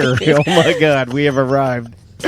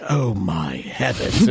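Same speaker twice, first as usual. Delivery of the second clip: prim shock; 9 semitones lower, 5 dB quieter, much slower than usual. Retold or imitated. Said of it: "I bet she just was like".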